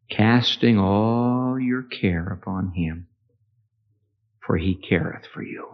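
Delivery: slow, soft verse; hushed reading of Peter's words; tender scripture reading. Acted. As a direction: read it gently to comfort. Quoting scripture softly.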